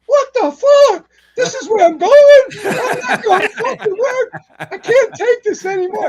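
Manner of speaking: high, loud, outraged disbelief with a laugh; outraged buddy. Retold or imitated. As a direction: squeal it out like a horrified rookie. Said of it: "He was like".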